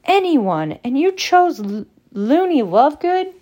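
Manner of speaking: high, loud, swooping incredulity; can't believe the choice, voice shooting up; disbelief. A narrator's reading. Ron to his friend over dinner, disbelief. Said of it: "said Ron in disbelief".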